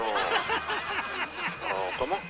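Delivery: very high, drawn-out laughing question; real laughter while asking. Real, not acted. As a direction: squeal it out through laughter. Laughing, searching for a name.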